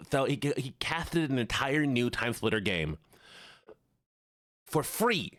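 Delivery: lisping nerd; put-on nerdy lisp. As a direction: talk like an incredulous lisping nerd. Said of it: "All right, the nerd voice".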